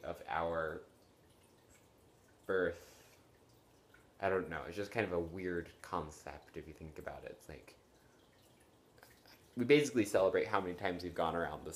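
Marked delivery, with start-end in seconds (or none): none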